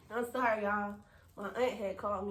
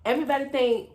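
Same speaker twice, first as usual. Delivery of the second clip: usual speaking voice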